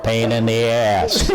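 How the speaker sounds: slow monotone electrolarynx imitation; imitating dad's buzzing voice box; voice-box impression. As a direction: drag it out on one mechanical note. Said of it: "learn to talk with a voice box".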